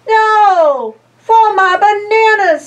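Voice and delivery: high, loud monkey protest; lively high monkey voice. Acted. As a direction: cry out for the bananas. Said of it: "says the monkey".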